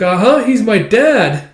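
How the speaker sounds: high, slow, emphatic confirmation; excited child. Retold or imitated. Child confirming the father to a villager, excited. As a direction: insist happily, like a child.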